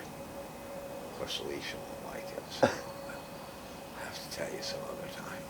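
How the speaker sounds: whispered aside, slow; conspiratorial whisper, slowing down. Real, not acted. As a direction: breathe it so Alicia won't hear. Aside kept secret from Alicia.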